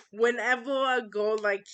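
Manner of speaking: ordinary talk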